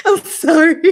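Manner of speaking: high, loud, through a laugh; laughing apology. Real, not acted. Friend laughing at the novel, laughing apology. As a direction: apologize while cracking up.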